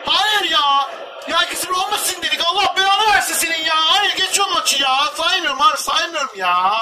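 loud, high angry shouts; furious yelling. Real, not acted. Gamer raging at the conceded goal, furious yelling. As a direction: yell in outrage at the goal.

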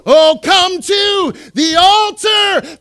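loud, high, slow shout; cranked up. Shown as a demonstration. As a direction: belt it out with full enthusiasm. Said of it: "crank that up a little bit".

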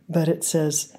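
ordinary talk, nothing marked

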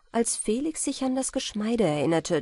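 usual speaking voice

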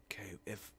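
unvoiced whisper. Real, not acted. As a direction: whisper it under your breath. Whispered aside while building.

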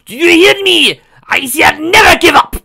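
very high, loud French shout; yelling. Acted. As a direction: bellow it at the whole forest. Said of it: "and yelled".

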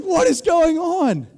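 disbelief. Retold or imitated. Watching others catch all fish.

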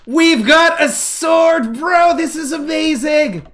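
high, drawn-out excited cheer; thrilled celebration. Real, not acted. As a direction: crow over a new prize. Celebrates getting a sword.

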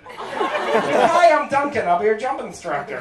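sashay in and introduce yourself sweetly; a prancing instructor greeting the buddy; flamboyant instructor. Retold or imitated. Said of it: "he immediately goes for him".

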